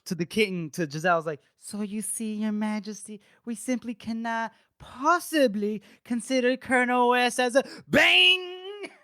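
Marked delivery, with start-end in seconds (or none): 1.6-7.6 mild pompous courtier. Retold or imitated. Councillor lecturing the king, interrupted. 7.9-8.9 high, loud cry of bang; shouted bang sound effect. Retold or imitated. Telling how the goblet hit the councillor, yelling bang.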